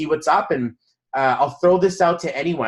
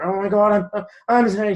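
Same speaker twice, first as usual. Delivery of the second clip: mock flustered apology, higher pitched; freaking out; 5 semitones higher than usual. Man imitating the lady who dented his car, freaking out. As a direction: imitate her panicky, stumbling apology. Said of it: "She's, like, freaking out".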